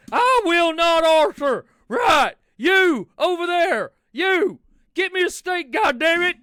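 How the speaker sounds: loud, high, furious demand; red-faced outlaw shouting at the waiter; Dutch yelling. Acted. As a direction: bellow for a steak like an outraged cowboy. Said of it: "Dutch, it don't matter".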